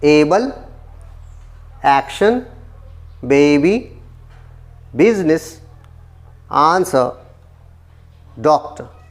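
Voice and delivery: each word of एबल, action, baby, business, answer, doctor hit on its first syllable; word-list demo, punching the opening syllable of एबल, action, baby, business, answer, doctor; strong first beat, lighter second on एबल, action, baby, business, answer, doctor; first-syllable stress. Shown as a demonstration. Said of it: "stress जो है first level के ऊपर है".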